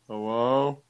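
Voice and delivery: loud hello through static; static-laced phone call; crackly cordless phone. Real, not acted. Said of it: "I also hear static".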